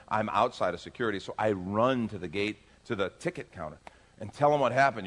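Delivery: usual speaking voice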